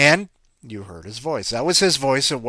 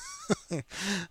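laugh; 10 semitones higher, 7 dB quieter than usual. Real, not acted. Chuckle at his own joke.

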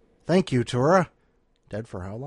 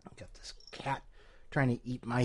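whisper; 10 dB quieter than usual. Real, not acted. Aside while cat attacks hand.

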